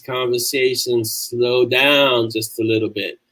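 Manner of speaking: drawn out slowly, as if easing into Southern talk; slowed-down Southern pace. Shown as a demonstration. Demonstrating for viewers how Southern talk slows, slow and unhurried. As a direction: let the words slow down and stretch. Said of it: "The slower feeling South, it is a real thing".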